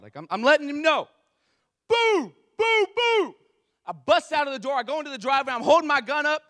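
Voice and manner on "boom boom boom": very high, hooted booms; loud bangs. Retold or imitated. Storyteller as the knocking returns, loud high booms. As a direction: imitate pounding in a high, loud hoot. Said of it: "three loud bangs on my door".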